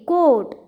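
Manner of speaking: "कोट" with a slightly lengthened O; long vowel. Shown as a demonstration. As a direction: stretch the O in "कोट" a little. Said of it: "थोड़ा सा लंबा sound हम यहाँ पे निकालते हैं". ("कोट" में लंबा ओ)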